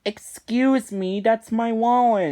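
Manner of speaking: a lightly voiced offended SpongeBob; indignant SpongeBob. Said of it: "and he's like".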